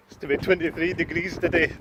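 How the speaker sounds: put-on Newcastle accent. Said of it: "parody of Mister Neil Morris".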